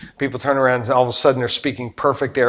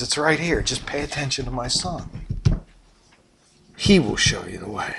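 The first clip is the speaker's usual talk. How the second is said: gentle voice of God; 2 semitones lower, 9 dB quieter than usual. Retold or imitated. God's voice quoted, gentle.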